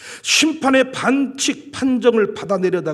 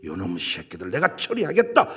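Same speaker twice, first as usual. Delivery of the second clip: much lower, a mock divine threat; God's voice; 13 semitones lower, 3 dB quieter than usual. Preacher voicing God punishing the people, low and threatening. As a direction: growl it low like God settling scores. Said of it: "이렇게 인정받고 싶어서".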